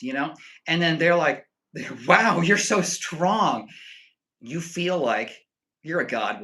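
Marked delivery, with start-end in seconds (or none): 2.1-3.7 loud, drawn-out, higher-pitched gushing; gushing admiration. Retold or imitated. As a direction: imitate an awestruck admirer, stretching every word. Acting admirers gushing at his muscles.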